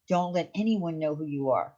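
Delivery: ordinary delivery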